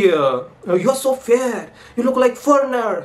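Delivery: a South Indian classmate tells the narrator he looks foreign, in a high mock South Indian accent; South Indian guy. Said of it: "south का लड़का है".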